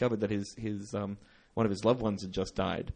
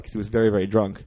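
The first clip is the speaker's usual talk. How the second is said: usual speaking voice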